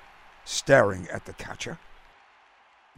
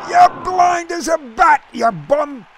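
much higher, louder heckling yell; angry shout; 8 dB louder than usual. A narrator's reading. Jack heckles the umpire over the call, angry shout. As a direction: blast the umpire from the stands. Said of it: "Jack shouted".

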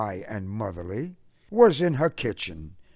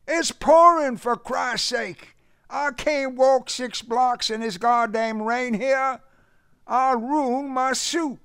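distressed complaint; 14 semitones higher, 4 dB louder than usual. A narrator's reading. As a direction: complain urgently in a high Southern voice. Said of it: "a convulsed voice shouted in distress".

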